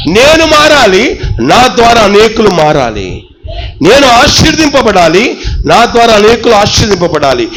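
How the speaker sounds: rising, rhythmic sermon chant; fervent preaching. Acted. Pastor urging the church, fervent preaching. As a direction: preach each line to the congregation.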